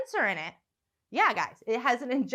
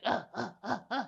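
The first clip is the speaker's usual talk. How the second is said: imitated grunting; 2 semitones lower, 6 dB quieter than usual. Comic imitating a bush flasher, grunting. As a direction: mimic a man grunting, kept quiet. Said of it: "jacking off and grunting".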